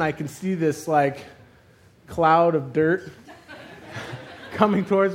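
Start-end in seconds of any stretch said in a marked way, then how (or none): none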